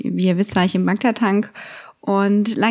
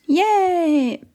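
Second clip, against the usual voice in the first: excited yay; 7 semitones higher than usual. Real, not acted. Cheering moving on to episodes.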